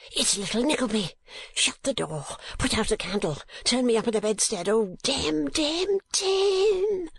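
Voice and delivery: choked high cries, rising to a squeal; suffocating voice. A narrator's reading. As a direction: gasp out orders as if smothered under bedclothes. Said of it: "he cried in a suffocating voice".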